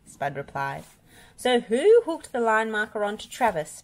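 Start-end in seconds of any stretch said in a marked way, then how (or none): 1.4-3.8 raised, insistent question; demanding. A narrator's reading. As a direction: press for the culprit, pitch up. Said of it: "Bob demanded".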